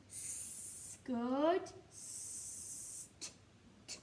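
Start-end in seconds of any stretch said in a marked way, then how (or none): 0.1-1.0 hissed unvoiced "s"; a long hissed "s", no voice; sound S. Shown as a demonstration. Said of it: "What sound can you hear at the beginning?". 1.9-3.3 breathy unvoiced "St" blend; sounding out st. Shown as a demonstration. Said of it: "What sound can you hear at the beginning?".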